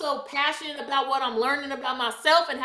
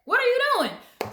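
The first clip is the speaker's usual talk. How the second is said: incredulous; 9 semitones higher, 4 dB louder than usual. Real, not acted. Scolding a homebody friend.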